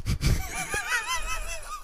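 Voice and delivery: high, helpless laugh; laughing at your own attempt; breaking into laughter. Real, not acted. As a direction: crack up mid-sentence. Breaks up at own French accent.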